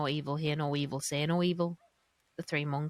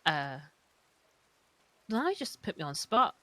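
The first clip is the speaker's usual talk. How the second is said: usual speaking voice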